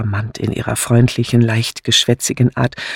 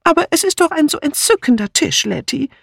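very high, fast, adoring; gushing Dora Banner; 14 semitones higher, faster than usual. A narrator's reading. Dora Banner to Letty about the table, high gushing. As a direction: gush over the lovely table.

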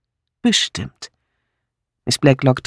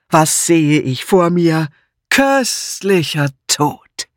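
slow, drawn-out grand declamation, pitched up; theatrical pathos; 4 semitones higher, much slower than usual. A narrator's reading. Patrick hailing the cake at table, theatrical pathos. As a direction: declaim it like a stage tragedian. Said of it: "rief Patrick pathetisch".

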